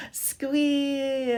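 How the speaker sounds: high squealing voice. Shown as a demonstration. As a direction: squeal the word like a piglet. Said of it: "Can you squeal?".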